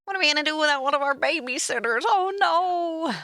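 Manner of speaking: high, fast whiny parent voice; mock worried parent. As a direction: ham up the panicking parents. Mimicking parents' whiny worry.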